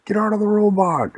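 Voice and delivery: high, very loud acted command; shouted police order. Police ordering heroes out of robot, loud high shout.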